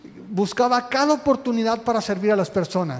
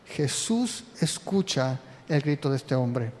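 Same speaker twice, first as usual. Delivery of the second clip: usual speaking voice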